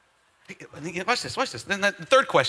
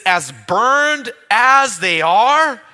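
slow loud mocking taunt; 4 semitones higher, 13 dB louder, much slower than usual. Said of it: "He says".

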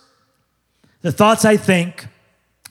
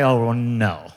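much lower, dismissive grumble; unimpressed mutter; 8 semitones lower, 4 dB quieter than usual. Retold or imitated. Pastor mocking unimpressed churchgoers, low dismissive grumble. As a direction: brush him off like a cold critic.

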